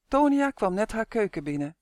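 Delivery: ordinary delivery, nothing marked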